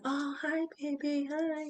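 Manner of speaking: dreamy hello through the anesthesia; drugged new mother. Retold or imitated. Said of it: "I was in under heavy drugs".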